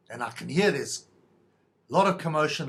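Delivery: normal talking voice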